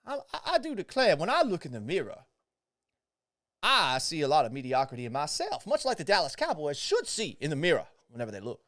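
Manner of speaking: hammed-up Southern gentleman accent, I do declare. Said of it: "dabble in a bit of a Southern accent".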